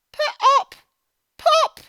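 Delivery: high, loud mock pop. Storyteller making the balloon pop loud for children. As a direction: burst out with a big pop.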